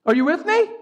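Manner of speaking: normal talking voice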